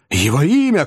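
high, insistent cry; shouted demand. A narrator's reading. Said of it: "крикнул Бен Эллен".

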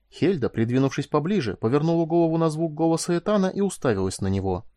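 ordinary delivery, nothing marked

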